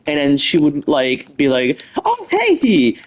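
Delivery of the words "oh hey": high-pitched, put-on innocent greeting; suspiciously nervous. Wife covering up to her husband, suspiciously nervous. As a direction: act caught out with an over-high hello. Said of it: "she would act all suspicious".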